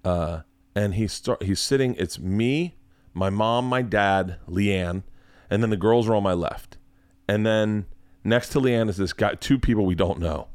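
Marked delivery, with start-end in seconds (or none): none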